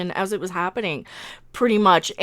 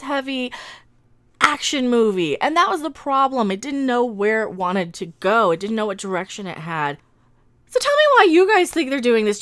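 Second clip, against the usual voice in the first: ordinary talk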